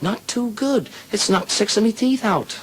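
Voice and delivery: high falsetto wife on the phone; put-on high female voice; Murphy's wife. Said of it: "She said".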